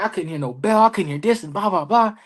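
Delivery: high-pitched retold griping; heated. Wrestler retelling how he complained about the missed bell, heated. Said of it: "I'm heated".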